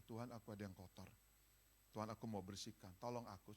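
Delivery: a low murmured confession; soft prayer. Shown as a demonstration. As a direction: pray it quietly, asking for help.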